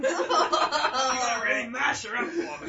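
loud, slow, through laughter; laughing shout. Pretend. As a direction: shout it cracking up.

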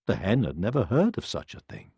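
usual speaking voice

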